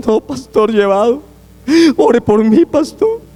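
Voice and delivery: hammed-up self-pitying moan; whiny gloomy believer. Pastor mimics a downcast member asking for prayer, mock whine.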